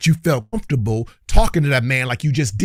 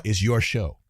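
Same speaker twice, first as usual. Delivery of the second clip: mocking quote; 3 semitones lower, 3 dB quieter than usual. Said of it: "when you then wanna talk about".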